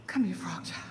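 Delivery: put-on low, near-whispered call to a child; hushed summons. Mama Frog calls her child close to warn him, hushed. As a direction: draw the child close in a hush. Mother gathers child for warning.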